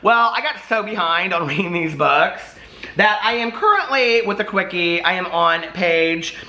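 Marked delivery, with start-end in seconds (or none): none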